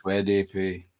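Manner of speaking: low, soft put-on voice; a silly low voice; funny put-on voice. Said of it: "Where did you get that voice from".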